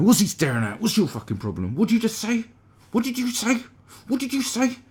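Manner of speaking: loud, high-pitched confrontational outburst; picking a fight over nothing; angry short man. Acted. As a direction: escalate into shrieked challenges. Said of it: "The angry short man".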